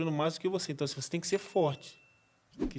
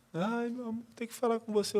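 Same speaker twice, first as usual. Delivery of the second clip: higher, softer imitation of a tearful call; imitated tearful wife; 6 semitones higher, 4 dB quieter than usual. Wife phoning her husband in tears, mildly imitated tearfulness. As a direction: lightly mimic a wife phoning upset. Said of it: "minha esposa me liga chorando".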